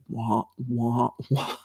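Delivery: soft low wah wah drone; mock muffled adult drone; Charlie Brown teacher wah-wah. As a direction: quietly mimic the Peanuts teacher voice. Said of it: "it's like".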